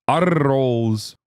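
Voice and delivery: "arroz" with a long trilled R; rolled R. Shown as a demonstration. Demonstrating a spicy rolled R.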